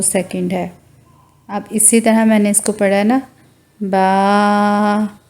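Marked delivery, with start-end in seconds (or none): 3.8-5.2 बा held for two seconds, long vowel; two-second baa. Shown as a demonstration. Teacher demonstrates the two-second length of बा. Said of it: "दो second इतना लंबा किया है".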